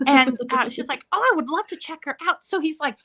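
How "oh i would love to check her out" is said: quick, high imitation of enthusiasm; mock-eager, higher and faster; keen teacher. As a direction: gush like an interested teacher. Teacher's keen reply, acted out.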